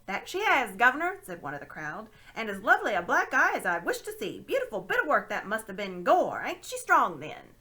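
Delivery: loud, cheeky London voice; cockney bystander. Acted. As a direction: relish the gossip.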